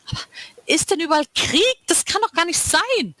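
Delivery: high, disbelieving cry; shocked disbelief. A narrator's reading. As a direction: she can't believe what she saw. Lila shocked by the visions.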